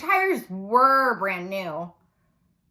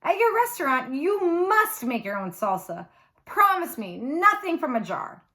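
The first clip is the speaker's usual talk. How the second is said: excited exclaim; 6 semitones higher than usual. A narrator's reading. Mommy to Poppy over breakfast salsa, exclaiming in delight. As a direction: gush it out with her mouth half full.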